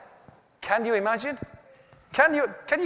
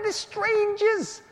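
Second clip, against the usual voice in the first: much higher, frantic complaint; husband panicking to wife about strangers and the telly; panicked high protest; 13 semitones higher, 5 dB louder than usual. Retold or imitated. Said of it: "I went".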